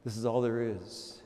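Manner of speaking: fading hushed repeat. Acted. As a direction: let it fade to almost nothing. Repeated, softer still.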